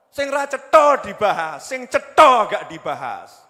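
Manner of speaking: loud, high, impassioned complaint; belted sermon tirade; full-force preaching. Real, not acted. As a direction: shout it across the congregation, hammering key words.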